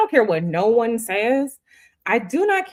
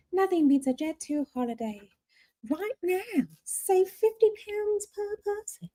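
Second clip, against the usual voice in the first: high, softer holiday-ad announcer imitation; Jet2 ad voice; 8 semitones higher, 6 dB quieter than usual. Streamer to viewers, softly imitating the Jet2 ad. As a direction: croon it like the advert jingle voice.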